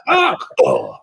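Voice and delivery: high squeaky yelp of a falling man; imitated falling yelp. Retelling the zombie man falling off, high imitated yelp. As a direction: squeak it out like he's toppling off. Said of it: "he's just like".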